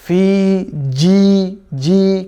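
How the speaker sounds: ফি জি slow and held, with a firm stopped j; hard jim. Shown as a demonstration. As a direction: press the j of জি hard and draw out the vowel. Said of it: "খুবই শক্ত করে উচ্চারণ করতে হবে".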